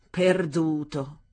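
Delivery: slow, dry mocking word; sarcastic. A narrator's reading. Father mocking the idea it was lost, sarcastic. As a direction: drag it out with scorn. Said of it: "in tono sarcastico".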